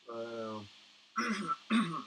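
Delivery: ordinary talk, nothing marked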